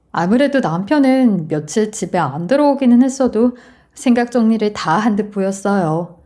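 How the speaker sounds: ordinary delivery, nothing marked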